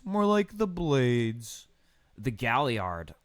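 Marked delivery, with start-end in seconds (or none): 0.0-1.7 mock pun.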